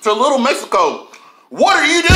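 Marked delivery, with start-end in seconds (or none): 1.5-2.1 high, loud outraged call-out; indignant shout. Retold or imitated. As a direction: yell it in disbelief. Ranting at taco shop.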